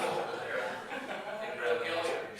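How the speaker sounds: loud, slow call to the crowd. Real, not acted. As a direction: call it out slowly across the room. Calling to the crowd.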